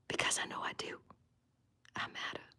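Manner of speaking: very soft breathy whisper; whispered certainty that I matter. Real, not acted. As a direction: barely breathe the affirmation. Final self-affirmation.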